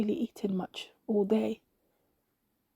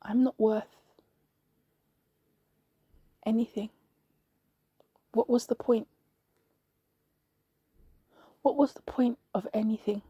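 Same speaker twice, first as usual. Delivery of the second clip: understated, weary hopelessness, with long pauses; dejected sigh. A narrator's reading. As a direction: sigh it out, halting between phrases. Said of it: "and I sighed".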